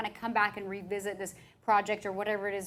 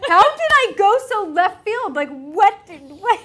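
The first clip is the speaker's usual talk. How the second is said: laughing disbelief; 9 semitones higher, 14 dB louder than usual. Real, not acted. Laughing at her own tangent.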